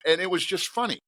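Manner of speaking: normal talking voice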